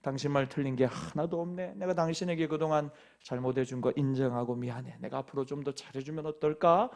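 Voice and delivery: soft, quiet, gentle apology to a wife; tender apology. Retold or imitated. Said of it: "가만히 듣고 있다가". (아내에게 부드럽고 조용히 사과하는 남편)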